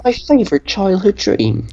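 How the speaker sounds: put-on British, pitched a little up; British accent. Obliging the co-host's request, in a British accent. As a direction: ask it in an attempted British accent. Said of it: "In British, please".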